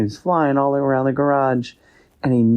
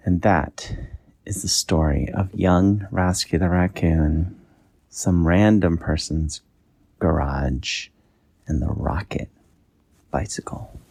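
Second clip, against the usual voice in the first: bedtime story ending; 4 semitones lower than usual. A narrator's reading. Parent ending a bedtime story, fading to a whisper. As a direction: trail off as the child drifts to sleep. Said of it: "bedtime story ending".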